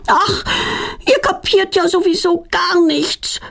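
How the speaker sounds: put-on high, shrill-free child's yell back at her parents. A narrator's reading. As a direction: shout it up high, fed up. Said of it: "brüllt Petronella zurück".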